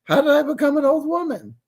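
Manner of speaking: put-on high, wavering old-lady voice; high mock-quavery mom; imitating old mom. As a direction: mimic your elderly mother's disbelief. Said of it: "She's like".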